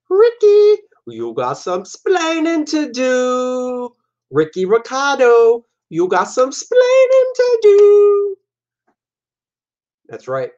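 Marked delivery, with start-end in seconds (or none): 0.1-3.9 high, slow mock Cuban accent, stretching splaining; Cuban accent impression. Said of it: "I grew up watching I Love Lucy reruns". 4.3-8.4 high, slow mock Cuban accent, stretching splaining; Cuban accent impression. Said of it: "I grew up watching I Love Lucy reruns".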